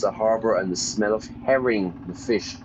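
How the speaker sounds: ordinary delivery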